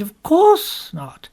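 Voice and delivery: a touch of arch politeness; drawn-out polite assurance. A narrator's reading. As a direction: assure her while privately doubting. For I wondered very much.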